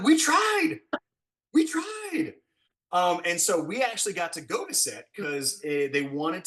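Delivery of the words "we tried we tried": high, strained squeak, much higher and louder; squeaky apology. Retold or imitated. Blacksmiths answering the actress about her heavy hammers, sheepish high squeak. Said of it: "And we went".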